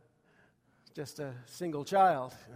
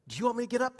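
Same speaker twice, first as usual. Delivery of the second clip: quoting dad; 6 semitones higher, much faster than usual. Retold or imitated. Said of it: "that's all he had to say".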